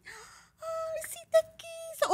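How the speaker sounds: high, crying gushing mother; mock gushing mom. Imitando a la mamá. (mamá emocionada, aguda y llorosa)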